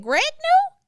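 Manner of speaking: high, loud astonished question; shocked disbelief. Retold or imitated. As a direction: gasp it out in disbelief. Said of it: "I said".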